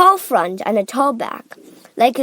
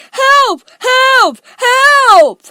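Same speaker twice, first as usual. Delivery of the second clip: high, full-voiced shouts; yelling for help; 12 dB louder, much slower than usual. A narrator's reading. Augustus in the chocolate, yelling for help. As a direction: yell each cry louder. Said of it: "he yelled".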